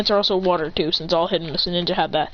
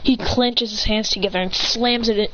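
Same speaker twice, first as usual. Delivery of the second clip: ordinary delivery, nothing marked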